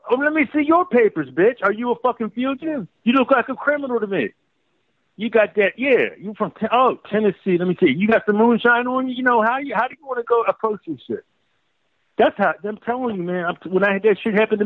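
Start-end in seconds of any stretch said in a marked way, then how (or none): 0.1-11.2 high, sneering cop impression. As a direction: taunt him like a bigoted officer. Mocking a cop demanding papers.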